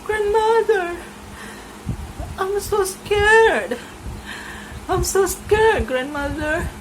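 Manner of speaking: put-on very high, slow, frightened child's voice; a tiny girl clinging to granny; scared little girl.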